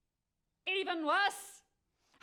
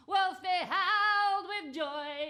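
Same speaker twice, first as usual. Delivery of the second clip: joyful howl; 8 semitones higher, 7 dB louder, slower than usual. Acted.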